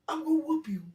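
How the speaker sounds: high, loud mock threat; hammed-up whooping threat; threatening uncle. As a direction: yell it as the angry uncle. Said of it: "He said".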